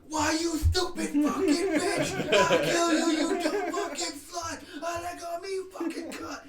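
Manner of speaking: high, screaming killer doll rage; berserk rage. Retold or imitated. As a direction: shriek it like a possessed doll. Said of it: "And he goes".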